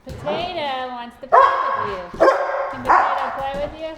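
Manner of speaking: bright excited pet voice; talking to a dog. Real, not acted. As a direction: coax the puppy to play. Talking to puppy, high loud.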